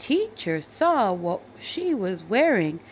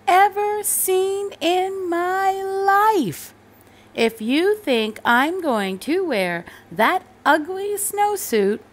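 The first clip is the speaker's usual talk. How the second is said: put-on loud, high, slow outraged boy; a kid spitting disgust at the ugly suit; Thomas refusing; 7 semitones higher, 7 dB louder, slower than usual. A narrator's reading. Boy rejects the snowsuit his mother bought, outraged. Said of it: "he said".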